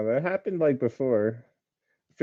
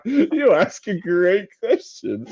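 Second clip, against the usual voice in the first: put-on voice; 7 semitones higher, slower than usual. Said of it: "I'm like".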